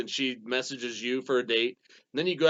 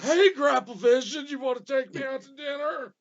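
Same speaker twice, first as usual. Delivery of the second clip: hammed-up cheerleader in a raspy wrestler's voice, much higher and louder, slowed down; mock-flirt like a wrestler cutting a promo; Brian Pillman voice; 10 semitones higher, 6 dB louder, slower than usual. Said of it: "she sounded like Brian Pillman".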